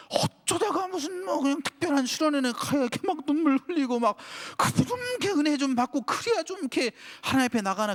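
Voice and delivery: 점점 높아지는 열정적인 설교; 목소리를 높여 몰아붙이듯 설교; rising preaching. Acted. Preaching builds in force. (높고 힘찬 설교 톤으로 몰아가기)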